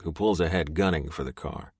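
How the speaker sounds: plain reading voice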